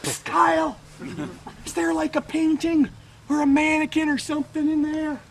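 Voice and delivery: high-pitched mock-nervous question; sheepish tour visitor. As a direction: pipe up high, like someone sidling up to ask. Acted timid question from tour visitors.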